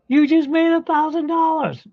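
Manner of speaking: excited announcement. Retold or imitated. Friend telling him he hit the jackpot, high excited squeal. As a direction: squeak it out like you can't believe it.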